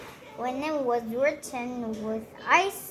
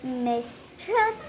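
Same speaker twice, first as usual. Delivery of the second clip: ordinary delivery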